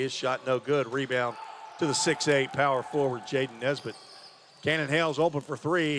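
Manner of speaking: animated basketball call, building to the shot; excited courtside commentary; live play-by-play. Real, not acted.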